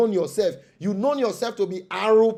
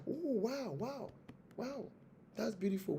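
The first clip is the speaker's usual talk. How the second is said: high, soft, lightly voiced gushing church official; mock delighted wow; 5 semitones higher, 13 dB quieter than usual. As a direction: coo in amazement at the plan.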